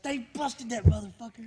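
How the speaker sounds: excited. Retold or imitated. Retold friend gleeful.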